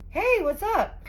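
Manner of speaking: high, forced casual greeting; a cornered man faking calm; fake casual. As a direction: play it cool, badly. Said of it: "trying to play it cool".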